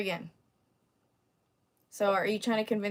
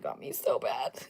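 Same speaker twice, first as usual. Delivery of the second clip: mock crying; 10 semitones lower, 6 dB quieter, slower than usual. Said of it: "I'm gonna cry about".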